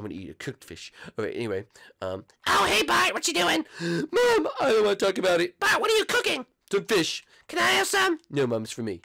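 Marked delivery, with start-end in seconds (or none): 2.5-3.7 high cartoon mom voice; sing-song motherly cartoon voice; mother greeting her son. Plays Marge the mom. 5.6-6.5 high cartoon mom voice; sing-song motherly cartoon voice; mother greeting her son. Plays Marge the mom. 7.5-8.2 high cartoon mom voice; sing-song motherly cartoon voice; mother greeting her son. Plays Marge the mom.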